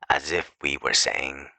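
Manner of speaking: ordinary delivery, nothing marked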